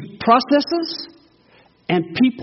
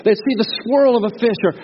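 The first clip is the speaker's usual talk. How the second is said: ordinary talk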